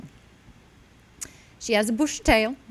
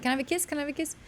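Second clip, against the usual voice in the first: put-on higher, soft coaxing baby talk; baby talk to dog; 7 semitones higher, 8 dB quieter, much faster than usual. Owner begging the dog for a kiss, soft and high. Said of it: "where I'm like".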